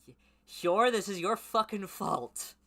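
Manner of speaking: loud, high, slow angry outburst; furious yell. Real, not acted. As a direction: yell the blame at Hyor. Yelling at character in story.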